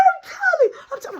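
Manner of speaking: very high, loud cry; hammed-up tearful squeal; mock tattling wail. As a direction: whine it out like a crying tattletale. Imitates boy crying to tell.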